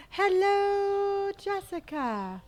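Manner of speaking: high, bright greeting; excited hello. Real, not acted. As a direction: sing out the hello. Greeting a viewer.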